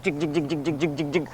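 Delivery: steady choppy rotor noise; imitated helicopter. Teller imitating helicopter between sheriff's calls, rotor chatter. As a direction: keep the blades chopping evenly. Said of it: "We hear this helicopter".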